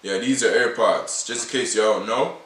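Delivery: ordinary talk, nothing marked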